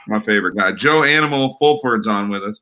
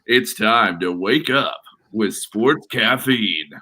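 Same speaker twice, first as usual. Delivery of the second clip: slow, drawn-out announcer read; Sports Caffeine radio voice; 3 semitones lower, much slower than usual. Shown as a demonstration. Host showing he can do the show's voice, radio announcer. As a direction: put on a smooth promo voice, unhurried. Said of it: "I actually can do that. The voice".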